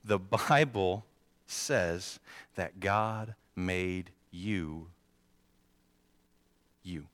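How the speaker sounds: very slow, spacing out each word; drawn-out emphatic repeat. Shown as a demonstration. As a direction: stretch every word for the ones who missed it. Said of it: "Let me say that one more time".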